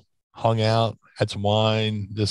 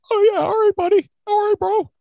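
much higher, louder meathead bro voice; Bruiser Bedlam imitation; 5 dB louder than usual. Wrestler imitating a locker-room bro to his podcast host, high and loud. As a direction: ham up a gym bro sizing you up. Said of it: "Johnny Canine quoted, same Bruiser voice".

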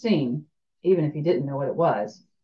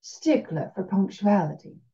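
Cajun drawl; 3 dB quieter than usual. Acted. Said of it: "Her voice had a slow drawl".

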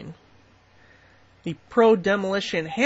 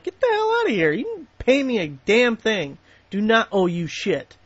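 retold telling-off; 3 semitones higher than usual. Retelling telling the company off.

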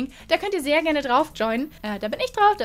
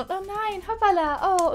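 higher, breathy mock drama heroine; mock K-drama fall; 7 semitones higher than usual. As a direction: overplay the clumsy fall like a K-drama. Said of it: "wenn Leute aufeinander fallen". (gespielte K-Drama-Heldin, höher)

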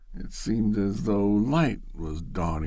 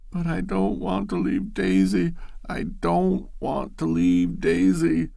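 high, sobbing plea, slowing on the repeat; tearful pleading; 9 semitones higher, 3 dB louder than usual. Acted. Loretta sobs to Bashford about leaving Daisy, tearful. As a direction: weep the words into the cushion.

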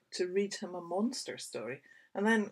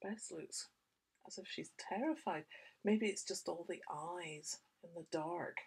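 usual speaking voice

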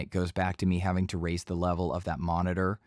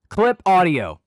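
high, much louder shout; deliberate shout; 10 semitones higher, 13 dB louder, slower than usual. Shown as a demonstration. Teacher clipping the audio on purpose, high loud shout. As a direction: shout hard enough to clip. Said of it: "I'm gonna clip the audio".

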